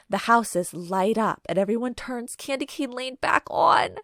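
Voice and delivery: usual speaking voice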